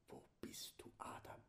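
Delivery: voiceless whispered call to Adam. Shown as a demonstration. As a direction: whisper as if God calls in the dark. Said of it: "Das Evangelium geflüstert". (geflüstert, als riefe Gott Adam)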